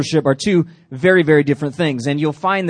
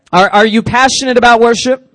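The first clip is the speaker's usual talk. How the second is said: louder repeat; 6 semitones higher, 9 dB louder than usual. Real, not acted. Pastor repeating his question louder to the crowd.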